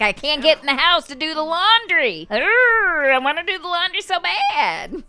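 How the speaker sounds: hammed-up complaining voice with a groan in the middle; grumpy ranch hand. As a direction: whine about the laundry like a cartoon farmhand. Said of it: "He's like".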